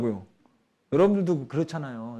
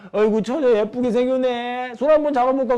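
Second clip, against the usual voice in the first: put-on drunken old man; 8 semitones higher, 6 dB louder than usual. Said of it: "취객인데도 불구하고 할아버지가 나를 향해서 와". (취한 할아버지가 치근대는 흉내)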